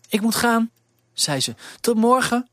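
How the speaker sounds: poor servant girl, lightly voiced; young girl Elise. Elise takes leave calling.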